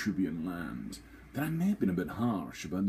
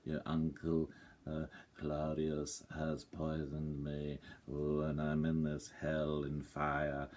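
mock ghost of Hamlet's dad; 3 semitones lower, 5 dB quieter than usual. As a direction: drone it like a ghost who isn't really angry. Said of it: "sort of goes".

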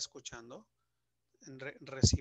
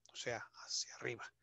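whisper; 2 semitones lower, 7 dB quieter, faster than usual. Real, not acted. Teacher aside after explanation.

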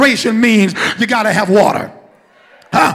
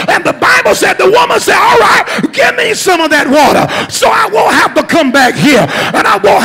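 loud, ringing sermon delivery; preaching; 6 semitones higher, 4 dB louder than usual. Acted. Preacher retelling the well story to the congregation, ringing preaching. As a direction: preach it out to the church.

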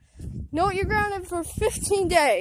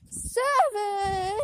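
ordinary talk, nothing marked

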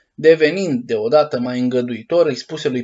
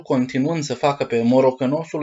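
ordinary delivery, nothing marked

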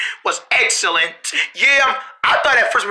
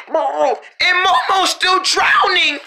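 panicked; 7 semitones higher, slower than usual. Acted. Friends panic, friend drowning.

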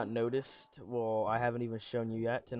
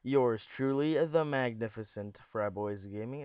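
announcer intro; 3 semitones higher, 3 dB louder than usual. Acted. YouTube intro greeting.

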